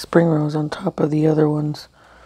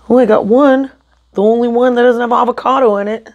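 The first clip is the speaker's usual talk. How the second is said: louder and higher, snippy and fed up; irritated attitude; 5 semitones higher, 6 dB louder than usual. Real, not acted. Vlogger snapping at camera over her only good spring roll. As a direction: snap it out with attitude. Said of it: "I'll drop the attitude".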